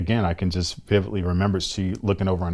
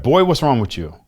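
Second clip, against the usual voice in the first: raised, louder voice of a questioning father; imitating father; 6 semitones higher, 6 dB louder than usual. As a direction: call your son out, higher and louder. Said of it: "He's like".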